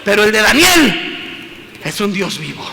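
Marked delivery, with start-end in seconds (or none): 1.8-2.7 low, soft hushed aside; quiet murmur. Acted. Preacher hushes the line before proclaiming it, quietly. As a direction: drop it to a hush before the shout. Quiet then shouted repeat.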